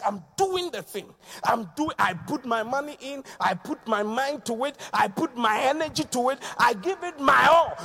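a touch of an eager churchgoer's boast; lightly voiced keen volunteer, rising; eager worker. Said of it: "It's like".

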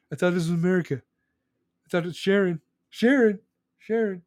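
a hint of a cartoon dad; lightly voiced whiny dad calling for his wife; Randy Marsh impression. Lightly voiced dad calling out to his wife Sharon. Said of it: "Randy Marsh is my favorite".

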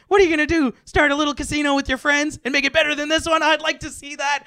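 high, loud, squeaky cartoon kid. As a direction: taunt like a bratty little kid. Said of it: "the squeaky kid voice".

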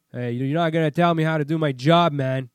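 loud, hard mock snap back; hostile retort. Towing guy answering the driver: loud, hostile imitation. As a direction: bark it like a defensive worker.

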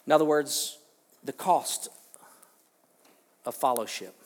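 loud, ringing preacher emphasis; fiery pulpit emphasis; preaching at full force. Acted. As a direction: proclaim it to the back pews.

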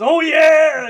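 Kool-Aid Man yell. Retold or imitated. Magnus bursts through the wall with a huge mock Kool-Aid Man yell. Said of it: "he kicks that fucking wall and he goes".

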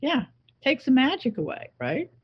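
usual speaking voice

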